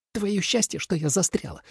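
high, hushed, angry hiss; hissed threat. A narrator's reading. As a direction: threaten under your breath. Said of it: "прошипела Суэлань".

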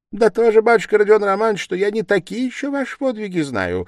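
high, animated, insinuating; knowing investigator. A narrator's reading. Investigator hinting he knows of exploits, knowing and animated. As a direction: chatter knowingly, pitch lifted high.

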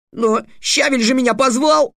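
put-on a hurt boy protesting; upset boy. Boy Halanberi voiced.

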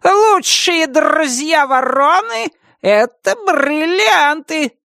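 high, slow, gleeful crow squawk; happy crow voice. Acted. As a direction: caw it out with delight. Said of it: "радостно каркнула".

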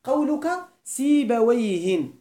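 slow سيبويهن with a clear final -in ending; nunation demo. Shown as a demonstration. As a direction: stretch out سيبويهن and sound the nunation. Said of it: "مثال ذلك".

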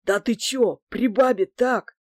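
mildly astonished man's protest; taken aback. A narrator's reading. Man objects to swearing before a woman, mildly amazed. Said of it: "изумился Мишаня".

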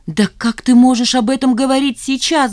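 high, loud indignant outburst; shouting. A narrator's reading. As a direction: shout at him in outrage. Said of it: "закричала Жанна". (возмущённый крик)

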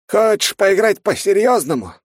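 put-on high, loud, slow old mage voice; frowning old mage. A narrator's reading. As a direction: frown and threaten like an old wizard. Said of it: "нахмурился маг земли".

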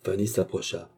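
usual speaking voice

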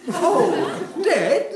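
shocked high squeak; surprised. Acted. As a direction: react with disbelief. Shocked reply.

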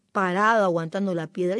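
ordinary delivery

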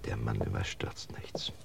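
whispered reassurance on a stakeout. Acted. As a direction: breathe it, barely a sound. Tense stakeout, keeping voices down.

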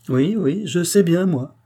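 high, loud, eager "je sais bien" of a flattered chambermaid; flattered maid. Acted. The maid chimes in to the men, high and flattered. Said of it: "fit une voix flattée".

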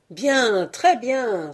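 put-on a drunken band hailing the deal, loud and high; drunken cheer. A narrator's reading. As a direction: cheer it out like a rowdy crowd. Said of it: "s'écria toute la bande".

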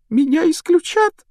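put-on high, plaintive girl's wail; tearful. Girl fearing expulsion from school, tearfully high. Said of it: "плакала Джинни".

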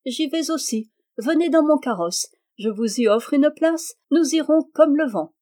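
high, spirited princess; lively invitation. A narrator's reading. Princess offers her husband a carriage ride, lively and inviting. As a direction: tempt them along with a lifted voice.

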